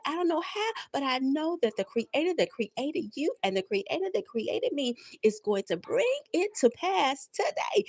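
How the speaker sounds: normal talking voice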